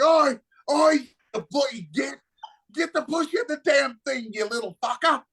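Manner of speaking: high, excited Cockney holler; crazy Cockney accent. Retold or imitated. Drunk friend urging on the rickshaw driver, crazy Cockney accent. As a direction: imitate a rowdy drunk Cockney egging someone on. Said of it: "his crazy Cockney ass accent".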